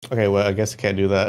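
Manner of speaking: quick reading of the AI's answer; fast, quick reading of a chatbot refusal; reading out the reply. Retold or imitated. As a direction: rattle off the bot's reply fast.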